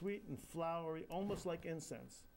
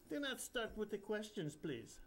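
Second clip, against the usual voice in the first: put-on higher Indian accent, mildly stern; instructing Indian guru; 6 semitones higher than usual. Bapucharya hushing the confused narrator, Indian accent. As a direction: wave off the questions, Indian accent. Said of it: "the voice of His Holiness the Bapucharya instructs".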